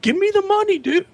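shrill high demand; mock exasperation. Imitating himself snapping at a friend, very high. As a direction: snap it out high and fed up. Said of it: "I was like".

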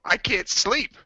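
high, whiny complaint; whiny pleading. Retold or imitated. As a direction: whine like a sleepless kid. Pleading with sleeping friend.

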